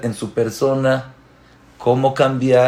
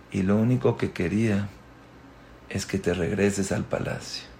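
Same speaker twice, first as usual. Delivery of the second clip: low, soft, measured father's reply; subdued, tender reassurance; gentle fatherly answer; 4 semitones lower, 6 dB quieter than usual. Acted. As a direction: explain softly to your child, with pauses. King gently answers his son.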